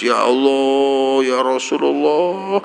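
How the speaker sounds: slow, drawn-out tearful cry to God; weeping prayer. Acted. Blind man weeping as he learns the truth, slow and tearful. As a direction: let the words break as he weeps. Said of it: "Nangis. Setelah nangis".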